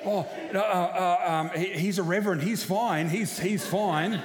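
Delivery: a friend caught out, scrambling to vouch for the reverend; flustered panic. Retold or imitated. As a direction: blurt it out, suddenly worried. Mate panics on phone to police.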